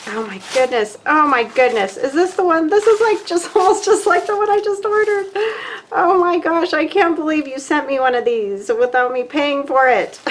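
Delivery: high, loud gasping delight, voice jumping way up; thrilled surprise. Real, not acted. Crafter opening a surprise gift from a friend, thrilled squeal. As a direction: squeal over an unexpected gift.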